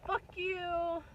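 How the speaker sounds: high put-on voice of spiteful life; life says fuck you again. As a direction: toss it off as a mocking jab. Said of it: "life just has this way of being like".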